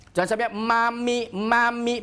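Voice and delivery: ordinary talk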